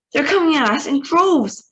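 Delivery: high, loud radio shout. Acted. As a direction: shout over the attack. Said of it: "Shruxman was shouting".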